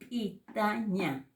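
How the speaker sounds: slow PITAÑA with a popped ejective p; glottalized P. Shown as a demonstration. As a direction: pop the first p of PITAÑA from a closed throat. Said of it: "que lo glotaliza".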